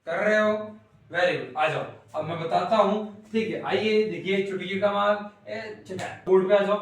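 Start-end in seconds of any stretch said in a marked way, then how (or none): none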